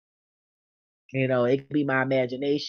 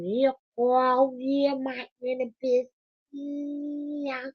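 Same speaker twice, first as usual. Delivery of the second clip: doting pet talk; 6 semitones higher, slower than usual. Real, not acted. Baby talk to her dog.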